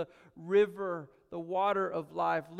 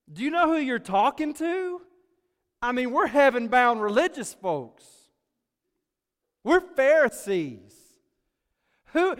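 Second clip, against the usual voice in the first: higher and louder, sneering Pharisee indignation; snide, contemptuous; 6 semitones higher, 8 dB louder than usual. Acted. Pharisees scorning Jesus as a no-name, loud and sneering. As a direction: look down your nose and scoff at the upstart. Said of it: "snide, contemptuous sort of way".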